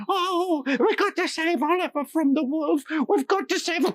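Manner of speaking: put-on very high, quavery old lady; fluttering and anxious; a flustered old village lady. A narrator's reading. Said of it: "the old lady said".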